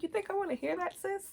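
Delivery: sassy retort. Retold or imitated. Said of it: "I'm like".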